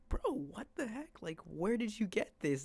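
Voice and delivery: high-pitched, incredulous retold reaction; astonished. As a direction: leap up high, demanding an answer from a friend. Confronting friend holding a Juul.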